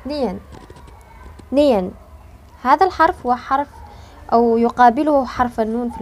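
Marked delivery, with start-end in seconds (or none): 0.1-1.9 نين repeated clearly for students; letter name. Shown as a demonstration. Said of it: "أول حرف ساكن سوف نتعلمه".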